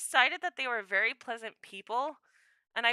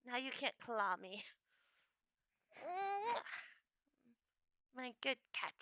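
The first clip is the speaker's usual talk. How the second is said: much softer murmur to the cat; 3 semitones lower, 16 dB quieter than usual. Real, not acted. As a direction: hush it to the cat in your arms. Holding the cat at last.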